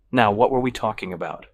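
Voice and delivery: plain reading voice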